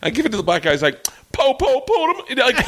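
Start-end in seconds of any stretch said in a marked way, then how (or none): 1.3-2.2 squeaky high mock cop-siren voice; mock high-voiced translator. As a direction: pitch way up, hammed-up translator.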